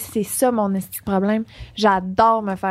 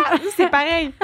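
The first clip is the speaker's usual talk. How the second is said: ordinary delivery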